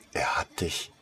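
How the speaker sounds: a stunned, breathy half-whisper that stops short; astonished, breaking off. Acted. Saleswoman stunned that Ollivander sent him, breaking off. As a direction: caught off guard, the words stall. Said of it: "begann sie in erstauntem Ton und stockte".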